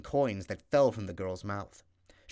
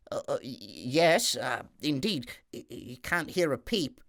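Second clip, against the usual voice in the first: put-on stammering kitchen boy; 3 semitones higher than usual. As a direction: stumble over every word in fright. Scared kitchen boy stammers to duck.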